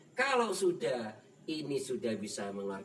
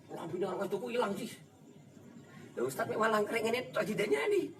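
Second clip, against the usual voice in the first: quoted complaint; 8 semitones higher, faster than usual. Retold or imitated. Retold complaint, quoted dialogue.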